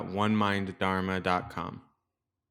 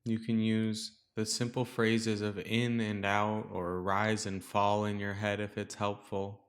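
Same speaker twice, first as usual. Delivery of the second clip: calm, softer meditation voice with long pauses; guided meditation; 5 dB quieter than usual. Real, not acted. Teacher guiding listeners to settle into the breath, calm meditation voice. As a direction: guide listeners gently into stillness, leaving space between phrases.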